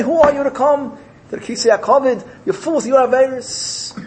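high-pitched, indignant challenge; scornful rebuke. Acted. Angels push sinners away.

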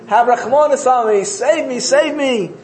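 high-pitched acted begging; desperate plea. Exiled son begs the king to save him, high pleading. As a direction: plead for rescue, voice pitched well up.

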